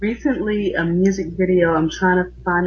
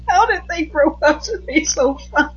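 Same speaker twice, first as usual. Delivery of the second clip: squealing laugh; 12 semitones higher than usual. Real, not acted. Gushing over Harry Potter actors.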